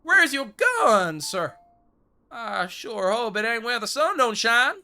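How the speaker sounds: put-on high, loud Western-accented scolding; hammed-up outraged cowboy shout; indignant mock yell. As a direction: holler in disbelief at the useless hired gunslinger. Berates gunslinger lacking a gun.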